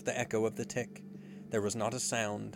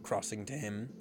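plain reading voice

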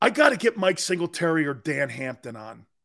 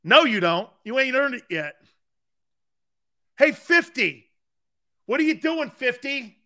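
high, loud yell at the player; Buddy Ryan needling Singletary at practice, loud; coach yelling; 8 semitones higher, 5 dB louder than usual. Retold or imitated. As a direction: put the rookie in his place, loudly. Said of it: "He goes".

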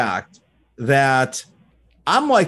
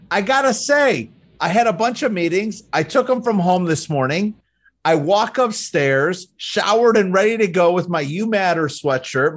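ordinary delivery

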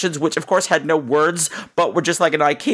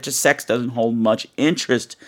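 ordinary talk, nothing marked

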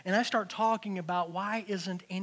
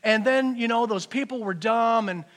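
loud, heated complaining to God; mock self-righteous outburst at God; acted angry rant; 5 semitones higher, 7 dB louder than usual. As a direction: vent like a fed-up, resentful believer. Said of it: "how quickly we go from praising the Lord to anger".

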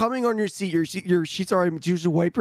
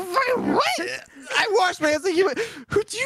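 high mock-indignant retort; mock outrage; 11 semitones higher than usual. Imitating his own reply, high mock outrage. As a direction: play yourself, outraged and high. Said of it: "I'm like".

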